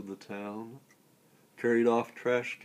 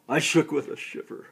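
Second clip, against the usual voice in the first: quick, higher narrated shiver; loud, performed recoil from the sea; dramatic shudder; 5 semitones higher, 9 dB louder, faster than usual. A narrator's reading. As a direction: recite it in a hurried burst of dread. Poem refrain, speaker shivering with dread.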